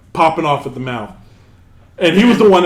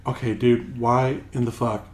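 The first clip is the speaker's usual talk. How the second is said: hushed, much softer, low murmured mockery; imitated whisper; 7 semitones lower, 10 dB quieter than usual. Gamer mocked for whispering late at night, hushed. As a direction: mimic a kid whispering so his parents won't hear. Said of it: "but, like, in a whisper".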